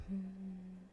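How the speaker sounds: ordinary delivery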